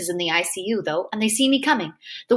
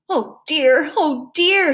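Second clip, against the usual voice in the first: higher, rising dismay; distressed; 5 semitones higher, 4 dB louder, much slower than usual. A narrator's reading. Nurse answers whether he will be okay, distressed. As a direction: lament it with mounting distress.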